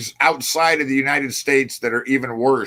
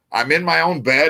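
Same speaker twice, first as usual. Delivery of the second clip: ordinary talk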